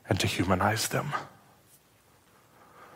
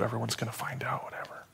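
panicked whisper; 2 semitones higher, 11 dB quieter, faster than usual. Retold or imitated. Chris panicking to his friend.

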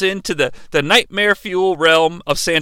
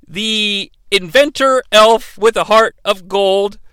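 ordinary delivery

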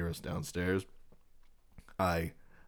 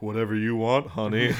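seductive; 3 semitones higher, 6 dB louder than usual. Pretend.

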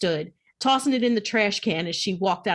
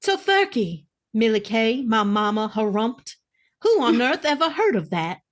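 indignant Southern mama twang; Southern twang, pitched up at the start. Acted. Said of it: "Her southern twang garnered a snicker".

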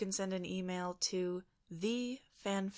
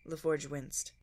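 ordinary delivery

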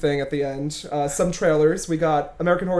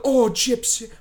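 higher attempt at a Southern accent; Patricia Arquette; 6 semitones higher, slower than usual. Host imitating the mother in The Act, Southern accent. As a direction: put on a shaky-grasp Southern accent. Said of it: "Patricia Arquette's accent, like, Southern".

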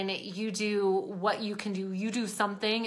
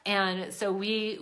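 normal talking voice

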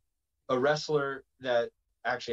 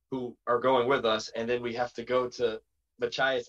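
ordinary delivery

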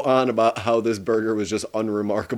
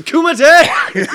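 huge high-pitched shout; yelled battle cry; 9 dB louder than usual. Acted. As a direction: yell it out like a fight announcer. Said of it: "you were gonna yell Kumite".

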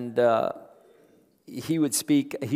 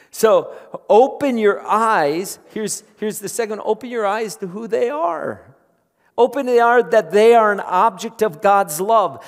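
loud, high, fervent preaching to the congregation; full-force preaching; 9 semitones higher, 10 dB louder than usual. Acted. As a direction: preach it out, rising and pressing.

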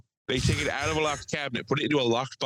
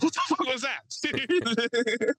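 high, slow shocked disbelief; stunned high squeal; 12 semitones higher, much slower than usual. Retold or imitated. Bellman shocked reaction.

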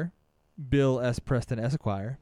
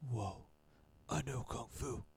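Keanu Reeves impression; 13 dB quieter than usual. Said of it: "he has that voice".

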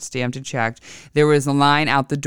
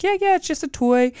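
much higher put-on voice; imitating husband's brush-off. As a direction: play him waving it away, high-pitched.

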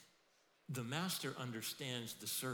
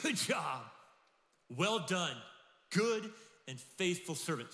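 loud, proud, slow commendation; the master lavishly praising, loud; master's praise; 6 semitones higher, 8 dB louder, slower than usual. Retold or imitated. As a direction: beam it out to a faithful servant.

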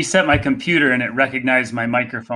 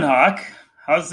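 usual speaking voice

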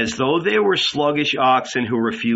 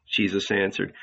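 plain reading voice

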